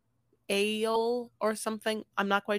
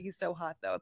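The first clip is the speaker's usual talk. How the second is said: ordinary talk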